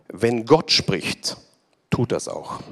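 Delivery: very slow, dropping low at the end; solemn preacher's refrain, drawn out. Acted. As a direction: let each word land slowly.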